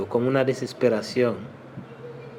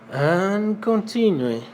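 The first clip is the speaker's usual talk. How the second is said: higher, slow and emphatic; announced next step; 6 semitones higher, slower than usual. Shown as a demonstration. Teacher moving the class on, higher and drawn out. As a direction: announce the next item, stretched out. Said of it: "higher slow take".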